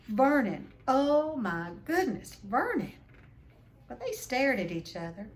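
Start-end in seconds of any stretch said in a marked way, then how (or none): 0.1-2.9 loud, high horrified wife; choking panic. Acted. Wife horrified by the letter, crying out to her husband. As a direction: gasp it out as if choking. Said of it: "made a choking noise".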